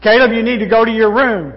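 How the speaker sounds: loud stern yell, ironically called gentle; mock-gentle yell at Caleb; shouted not gently. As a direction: bark it at the child. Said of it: "she said gently".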